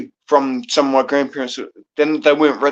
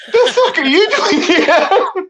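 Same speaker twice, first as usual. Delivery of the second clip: high, slow, laughing mock outrage at the auntie; mock auntie shout; 4 dB louder, slower than usual. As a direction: squeal it out laughing like the shocked auntie. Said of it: "I was going".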